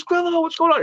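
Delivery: high-pitched hearty hello; retold delighted greeting. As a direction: act out an old friend greeting you. Don greets him in the story.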